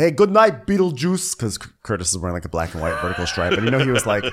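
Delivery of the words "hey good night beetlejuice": loud, high teasing good night; mock calling out. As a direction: call out a cheeky good night. Mimicking a man calling good night.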